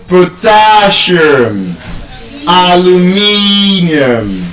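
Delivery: slow "Potassium, aluminium", TAS and MIN held long; penultimate stress. Shown as a demonstration. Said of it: "they are stressed on".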